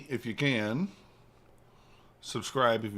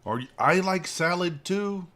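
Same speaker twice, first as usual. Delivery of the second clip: higher, slow pet dog voice; 7 semitones higher, 4 dB louder than usual. Retold or imitated. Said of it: "staring at me like".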